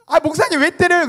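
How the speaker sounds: high, loud mock complaint; imitated hurt, indignant child; child's indignant protest. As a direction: protest like a kid who just got smacked. Slapped child protests, acted.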